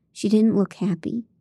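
ordinary delivery, nothing marked

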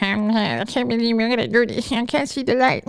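mock a gloomy person, soft; quietly moping pessimist; mopey doomsayer. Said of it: "another person talking about".

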